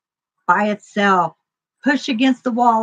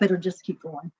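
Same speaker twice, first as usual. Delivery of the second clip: ordinary talk, nothing marked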